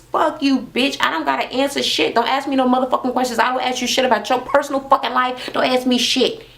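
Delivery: high, fast mock tirade; imitated cussing out. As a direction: go off on her, hammed-up. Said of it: "how mad she was".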